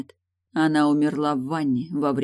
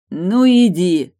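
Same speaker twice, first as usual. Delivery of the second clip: loud, drawn-out, higher-pitched shout; shouting after someone; 4 semitones higher, 10 dB louder, much slower than usual. A narrator's reading. Morton yells after Tilda as she leaves, shouting. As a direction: yell it slowly at a departing back. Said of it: "прокричал он ей в спину".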